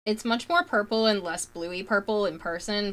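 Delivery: normal talking voice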